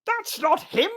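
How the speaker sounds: very high, loud protest; exasperated bellow. Acted. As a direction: bellow the correction.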